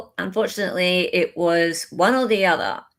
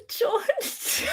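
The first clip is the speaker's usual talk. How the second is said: laughing; 5 dB quieter than usual. Real, not acted. Laughing call.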